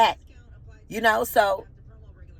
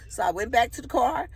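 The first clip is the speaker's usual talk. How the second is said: usual speaking voice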